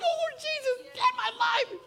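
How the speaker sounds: hammed-up churchgoer wailing for help; desperate plea to the Lord. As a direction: cry out to Jesus like you're at the end of your rope. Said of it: "you're gonna go home and be like".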